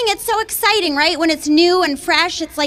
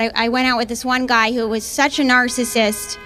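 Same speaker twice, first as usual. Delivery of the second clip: ordinary talk, nothing marked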